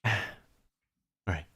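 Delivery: ordinary delivery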